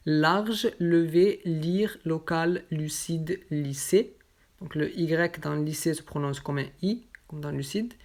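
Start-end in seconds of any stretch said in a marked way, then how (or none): none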